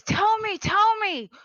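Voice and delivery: high, loud, frantic demand; desperate shouted plea for answers; retold scream. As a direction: yell it in their face, pitched right up. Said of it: "he screamed in their face".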